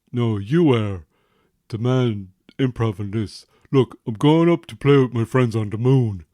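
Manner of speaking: put-on grumbling teen brushing off his father; sulky teenage son. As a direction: play the moody teen who's fed up with his dad. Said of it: "Why are you talking like that?".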